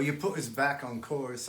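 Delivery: usual speaking voice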